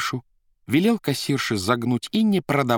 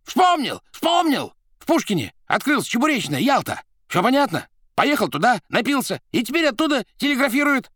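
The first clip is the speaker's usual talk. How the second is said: high, loud triumphant yells; excited shouting; 5 dB louder than usual. A narrator's reading. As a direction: yell out the discovery. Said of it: "подпрыгнул и закричал".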